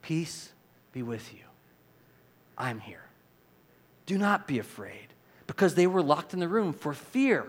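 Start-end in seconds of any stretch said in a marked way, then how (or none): 0.0-5.1 soft, slow, tender comfort; gentle reassurance. Shown as a demonstration. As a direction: hush frightened friends gently. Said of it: "He says it like this".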